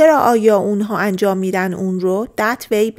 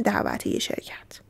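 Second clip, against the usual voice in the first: whispered gloss; 9 dB quieter than usual. Real, not acted. Aside to students.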